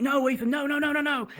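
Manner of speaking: high, urgent retold pleading; mock alarm. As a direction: imitate panicked classmates stopping someone. Classmates trying to stop Ethan.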